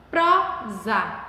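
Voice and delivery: "prosa" broken slowly into two separate syllables, pro, za; split into syllables. Shown as a demonstration. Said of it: "Paramos no pró". ("prosa" separado em sílabas: pro-za)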